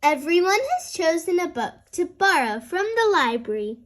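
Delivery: ordinary delivery, nothing marked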